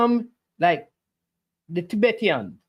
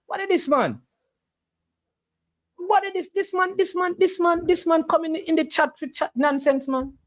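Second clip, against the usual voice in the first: pitched way up in disbelief; incredulous high mockery; 8 semitones higher than usual. Real, not acted. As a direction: scoff at him, voice high and mocking. Mocking chatter's nonsense.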